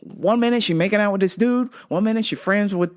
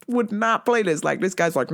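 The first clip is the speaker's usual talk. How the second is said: ordinary delivery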